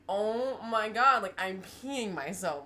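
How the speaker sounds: high, loud, slow shocked wail. Retold or imitated. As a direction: stretch it out in disbelief. Retold shock at peeing herself.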